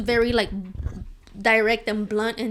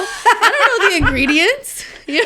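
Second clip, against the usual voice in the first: words bubbling up through laughter, higher and louder; laughing while talking; 9 semitones higher, 8 dB louder than usual. Real, not acted. Laughing as she admits she doesn't know the ingredients. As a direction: get the line out as the giggles take over.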